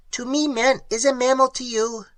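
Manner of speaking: high, strange alien voice; alien creature. Acted. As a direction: speak like a clicking cave creature. Creature answers.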